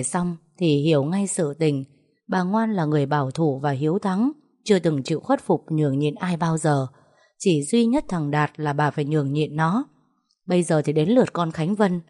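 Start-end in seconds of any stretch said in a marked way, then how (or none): none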